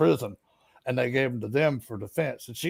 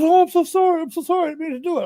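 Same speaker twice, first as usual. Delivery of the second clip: apologetic woman; 13 semitones higher, 4 dB louder, faster than usual. Retold or imitated. Ex-mother-in-law apologizing.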